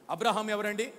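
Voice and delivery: normal talking voice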